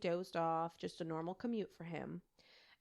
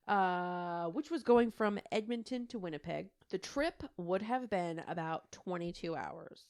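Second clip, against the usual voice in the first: normal talking voice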